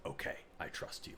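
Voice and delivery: father finally trusting his son, hushed; soft trust. Retold or imitated. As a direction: give in gently, sincere. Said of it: "And he says".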